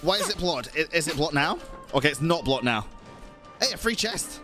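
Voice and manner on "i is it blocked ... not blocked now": normal talking voice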